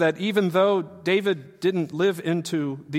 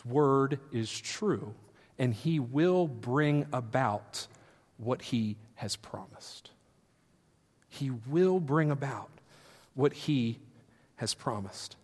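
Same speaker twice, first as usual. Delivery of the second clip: slow assurance; 3 semitones lower, 7 dB quieter, slower than usual. Real, not acted. Sermon climax, slow soft.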